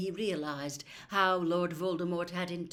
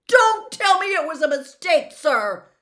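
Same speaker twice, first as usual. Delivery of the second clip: high, loud furious interruption; boy yelling at his headmaster; angry outburst; 10 semitones higher, 18 dB louder than usual. Acted. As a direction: cut him off shouting. Harry interrupts angrily.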